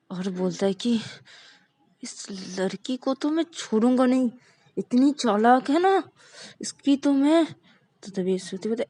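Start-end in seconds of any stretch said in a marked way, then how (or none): 2.0-7.4 raised, fuming threat under the breath; angry grumbling. Acted. Vikas fuming to himself about Rani, angry. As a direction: seethe about the clever girl, pitch up. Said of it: "तू इतना गुस्से में क्यों लग रहा है".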